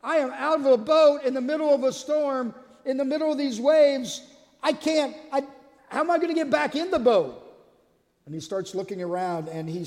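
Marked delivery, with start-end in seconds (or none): 0.0-7.3 higher, rushed, alarmed questions; a man scrambling in panic; panicked Peter. Retold or imitated. Peter panics mid-storm, high and alarmed. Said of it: "till he gets afraid".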